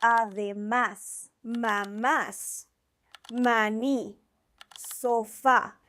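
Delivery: Además, mamás, maní, sofá, each stressed on its final syllable; stress on last syllable. Shown as a demonstration. Teacher giving examples of agudas words, final syllables of Además, mamás, maní, sofá stressed. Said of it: "strongest emphasis at the very end".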